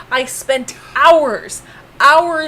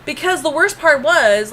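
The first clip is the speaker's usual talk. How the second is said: usual speaking voice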